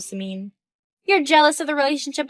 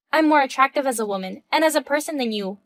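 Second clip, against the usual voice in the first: ordinary talk, nothing marked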